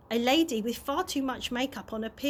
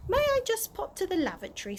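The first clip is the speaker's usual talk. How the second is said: polite Mister Stink; 8 semitones higher, faster than usual. A narrator's reading.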